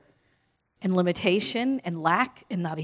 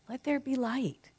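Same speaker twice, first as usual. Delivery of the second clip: higher, softer echo; inner cheerleader; 4 semitones higher, 7 dB quieter than usual. Pretend. Speaker echoing the inner cheerleader, higher and softer. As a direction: repeat it gently up high. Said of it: "Your inner cheerleader says".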